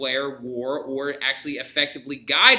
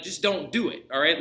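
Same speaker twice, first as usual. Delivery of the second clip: normal talking voice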